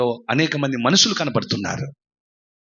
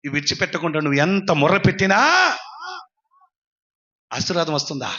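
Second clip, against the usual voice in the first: usual speaking voice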